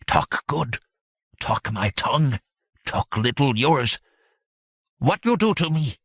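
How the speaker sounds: halting creature voice, rising to a question; broken-language satyr. A narrator's reading. Captive satyr answers his captor in halting broken speech. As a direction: speak haltingly, like a foreign creature. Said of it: "said in broken Aquilonian".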